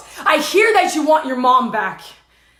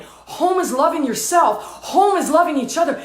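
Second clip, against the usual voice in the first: ordinary delivery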